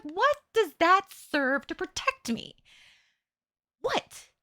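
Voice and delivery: high-pitched, incredulous demand; exasperated, pitch jumping up; exasperated self-questioning. Retold or imitated. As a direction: question yourself in drawn-out disbelief. Retelling exasperated questioning of herself.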